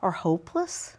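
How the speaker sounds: soft, tender reassurance; mother comforting her son after losing first chair, soft; empathetic. Shown as a demonstration. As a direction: comfort a disappointed child. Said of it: "that's the empathy".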